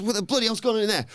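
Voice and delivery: high, fast, indignant boss voice; Adam Pearce impression, high and fast; mock Pearce. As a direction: rattle it off high like an outraged official. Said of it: "Pearce says".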